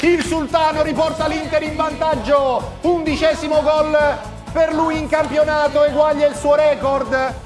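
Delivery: screaming goal call, high and loud; breathless italian goal commentary. Real, not acted. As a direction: roar the goal like a stadium commentator.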